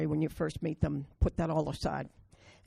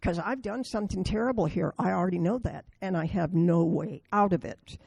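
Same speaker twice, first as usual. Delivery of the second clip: usual speaking voice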